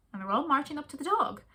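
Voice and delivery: usual speaking voice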